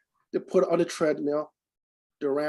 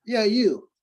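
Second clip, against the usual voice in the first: louder, higher retold shout to a stranger; imitating a call-out; 7 semitones higher, 7 dB louder than usual. Stranger hails him across the room, retold call-out. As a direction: call out as the man singling someone out.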